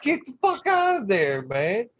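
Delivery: high, loud yell at the toad; exasperated yell. Retold or imitated. Shouting at the toad in his seat case, high and loud. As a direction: shoo the toad out, fed up. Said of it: "I'm like".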